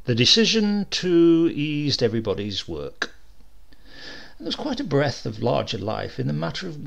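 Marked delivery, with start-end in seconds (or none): none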